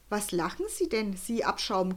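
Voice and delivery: plain reading voice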